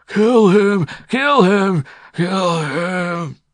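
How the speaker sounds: high, loud croaking chant; croaking old man. A narrator's reading. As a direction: croak the demand over and over. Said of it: "croaked the old man".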